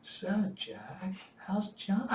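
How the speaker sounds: high, mock-sweet needling; mocking question. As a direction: imitate a superior's sarcastic small talk. Sergeant major mocks first-name familiarity.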